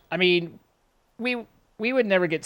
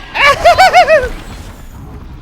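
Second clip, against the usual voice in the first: high burst of laughter; loud laugh; 12 dB louder than usual. Real, not acted. Laugh after being spotted.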